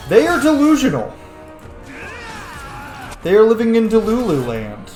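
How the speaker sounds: "they are delusional" pitched high and loud; loud, high-pitched dismissal of the doubters; emphatic insistence. Real, not acted. Emphatic scorn at critics.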